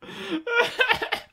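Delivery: high-pitched burst of laughter. Real, not acted. Reacting to the awkward date talk.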